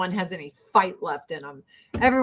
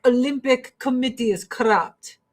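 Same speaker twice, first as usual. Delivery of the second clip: higher mock Russian accent, laughing at the end; Russian officials admitting the Olympic bribe, in a higher mock Russian accent; 3 semitones higher than usual. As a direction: play the Russian official cheerfully admitting corruption. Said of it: "They're like".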